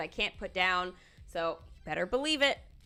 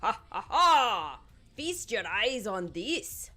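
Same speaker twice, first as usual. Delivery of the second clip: slow Scottish villain gloat; mock triumphant laugh and boast; Dingwall's boast; slower than usual. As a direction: ham up a gleeful ha ha ha. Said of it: "usually when he says that".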